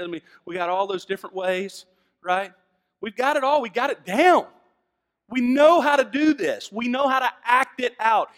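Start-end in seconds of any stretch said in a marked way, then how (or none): 3.0-8.3 loud, high, driving preacher; preaching at full force. Acted. Pastor mocking churchgoers' act, loud and high. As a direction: hammer home the church's act.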